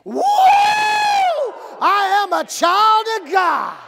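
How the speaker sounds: high whoop, then slow loud declaration; exuberant, stretched-out high shout; rallying group shout. Shown as a demonstration. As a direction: lead the crowd in a wrestler-style proclamation. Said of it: "On the count of three".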